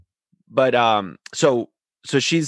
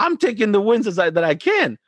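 higher, insistent retold protest; heated protest; 8 semitones higher than usual. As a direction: argue your case with the referee. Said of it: "I was like".

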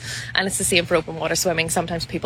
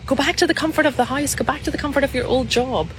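mock shouting; 7 semitones higher than usual. Inner voice urging you to retreat, high mock shout. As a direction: plead in a raised, mock-shouting pitch. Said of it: "every single part of you shouting at you".

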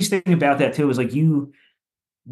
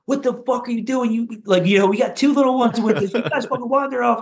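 imitating wife screaming; 10 semitones higher than usual. Wife screaming at her lost parents, a mild high-pitched mock yell. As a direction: retell the wife's outburst with a high mock yell. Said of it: "She starts fucking screaming at them".

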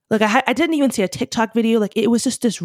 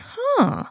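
high-pitched curious huh; retold little huh of interest; intrigued "huh". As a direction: let out a high, falling huh of piqued interest. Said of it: "kinda had me in another".

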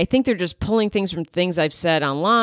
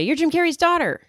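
high-pitched imitation of a stranger; lightly mocking, high-voiced accusation; mock amazement; 10 semitones higher than usual. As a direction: exclaim it like an imagined fan, pitched way up.

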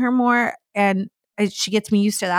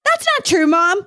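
put-on very high, loud kid contradicting mom; child's voice imitation; 9 semitones higher, 4 dB louder than usual. Daughter correcting her mother in front of a parent, high indignant child voice. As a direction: squeal it like an indignant little girl.

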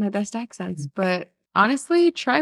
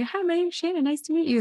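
much higher, soft introduction; polite greeter; 10 semitones higher, 4 dB quieter than usual. Pretend. Contestant introducing herself, high mock-polite greeting. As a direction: play a high-voiced polite newcomer.

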